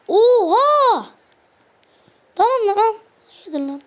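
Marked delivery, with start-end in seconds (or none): 0.1-1.1 high, loud whoa; loud astonished shout. Real, not acted. Gamer sees a wild play, loud astonished shout. 2.4-3.0 high, loud fed-up yell; exasperated shout. Real, not acted. Gamer loses patience with the match, loud fed-up yell. Frustrated at the game.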